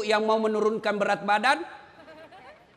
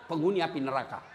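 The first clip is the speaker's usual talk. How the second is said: ordinary talk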